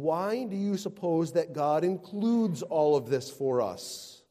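ordinary talk, nothing marked

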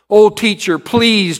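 put-on high, loud pleading child; begging. A narrator's reading. Jimmy begging the teacher for mercy, high and loud. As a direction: beg the teacher desperately. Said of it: "Jimmy begged".

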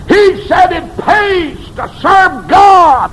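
high, loud, slow sermon cry; crying the line out at the peak of the sermon; shouted preaching. Acted. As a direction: shout it out and draw it long. Shouted take.

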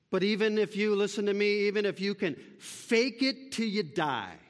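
high, loud fervent sermon; fiery pulpit appeal; impassioned preaching. Acted. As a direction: preach it hard to the congregation. Preaching at full force.

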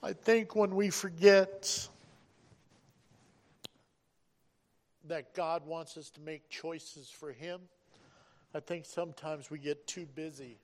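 sudden loud, slowed-down sermon peak; preaching hard. Real, not acted. Preaching at full force.

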